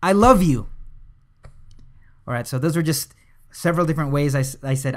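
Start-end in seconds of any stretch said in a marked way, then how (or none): 0.0-0.7 loud, high "love" falling hard; louder, higher "I love you" peaking on "love"; loud stress on LOVE. Shown as a demonstration. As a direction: burst out on "love". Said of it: "emphasize each different word".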